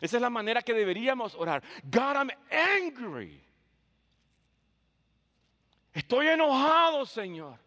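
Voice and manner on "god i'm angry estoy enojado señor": high, loud, slow cry of anger to God; angry prayer. Acted. Preacher voicing an honest prayer to God, angry outcry.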